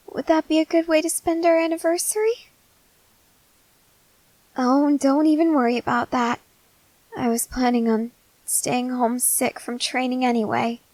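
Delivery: usual speaking voice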